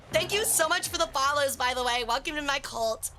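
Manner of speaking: ordinary delivery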